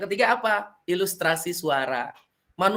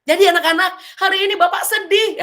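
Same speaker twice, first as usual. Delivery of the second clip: much louder, much higher gleeful announcement; teacher showing the wrong, happy voice for a sad story; mismatched cheerful voice; 13 semitones higher, 8 dB louder than usual. Shown as a demonstration. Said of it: "Kalau ceritanya sedih, jangan ekspresi gembira".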